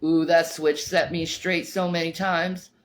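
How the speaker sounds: ordinary delivery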